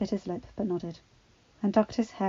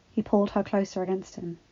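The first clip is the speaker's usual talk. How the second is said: ordinary delivery, nothing marked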